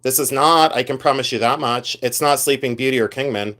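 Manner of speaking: usual speaking voice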